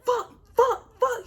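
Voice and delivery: high, panicked curse under her breath; panicked cursing. Retold or imitated. Driver on phone spotting police lights, panicked cursing. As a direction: swear in sudden alarm, voice shooting up.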